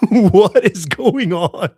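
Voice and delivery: high, loud, drawn-out laughing disbelief; mock freak-out. Driver recalling his shock in the car, mock freaking out. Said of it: "now I'm freaking out".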